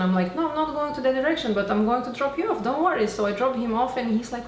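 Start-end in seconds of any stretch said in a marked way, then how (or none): 0.4-3.1 eager insisting. Retold or imitated. Insisting on the ride.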